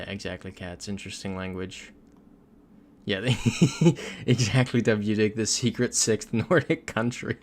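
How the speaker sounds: ordinary delivery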